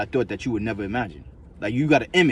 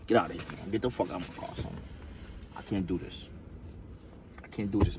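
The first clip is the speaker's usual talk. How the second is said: low muttered quote; 3 semitones lower, 10 dB quieter than usual. Retold or imitated. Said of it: "acting out kicking friend out".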